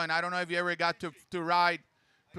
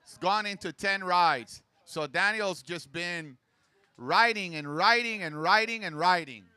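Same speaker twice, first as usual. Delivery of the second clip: higher, louder standings call; announcer voice; 4 semitones higher, 6 dB louder than usual. Real, not acted. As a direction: rattle off the places to the crowd. Calls standings over the PA.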